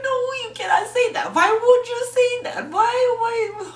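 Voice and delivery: high, loud, crying why; heartbroken, pleading with the character; tearful protest. Retold or imitated. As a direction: wail it out in tears.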